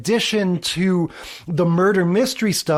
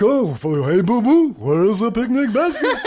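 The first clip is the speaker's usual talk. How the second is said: cartoon bear voice, laughing, higher by the end; Yogi Bear voice; 5 semitones higher than usual. Recalling what friends teased, hammed-up Yogi Bear voice. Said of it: "my French accent sounding more like the, you know".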